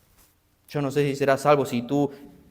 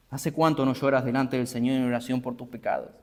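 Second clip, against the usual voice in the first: usual speaking voice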